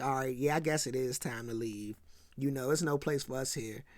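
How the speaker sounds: low, quiet, quick resignation; murmured, reluctant acceptance; resigned agreement. Retold or imitated. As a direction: imitate a crew giving in, voice dropped low. Crew reluctantly agree to leave.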